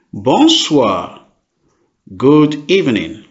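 ordinary delivery

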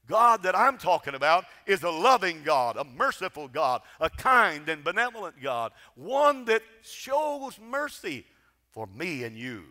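ordinary talk, nothing marked

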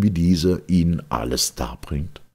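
ordinary delivery

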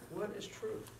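preacher echoing Pilate to the congregation, soft; quiet echo. Shown as a demonstration. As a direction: let the question hang softly.

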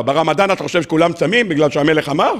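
ordinary talk, nothing marked

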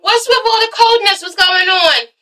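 high, breezy imitation; mock Carmen. Vlogger imitating Carmen greeting the cold ladies, high and breezy. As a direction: chirp it up high, playing innocent. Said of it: "Carmen's like".

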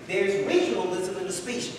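ordinary talk

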